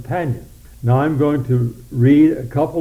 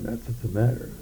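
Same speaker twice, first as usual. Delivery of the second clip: ordinary talk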